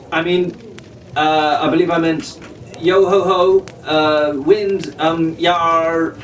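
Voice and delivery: unnatural pirate talk. A narrator's reading. Fitzwilliam awkwardly tries to sound like a pirate. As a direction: fumble a fake pirate cheer. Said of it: "he said quite unnaturally".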